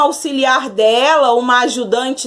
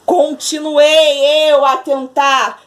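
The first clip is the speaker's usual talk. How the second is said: ordinary delivery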